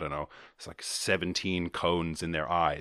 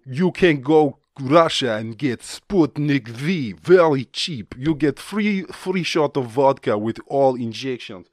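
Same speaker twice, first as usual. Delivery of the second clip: loud, high comic Russian salesman; Russian accent; 5 semitones higher, 6 dB louder than usual. Acted. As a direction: pitch it slowly and loudly in a thick Russian accent. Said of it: "I got carried away with the accent".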